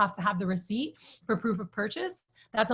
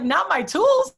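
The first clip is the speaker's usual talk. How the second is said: laughing, higher and louder; 10 semitones higher, 8 dB louder, slower than usual. Retold or imitated. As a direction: scoff it out through laughter. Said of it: "he starts laughing".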